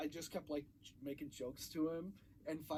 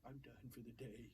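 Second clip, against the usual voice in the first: weary mom; 4 semitones lower, 12 dB quieter than usual. Retold or imitated. Mom sick after ride.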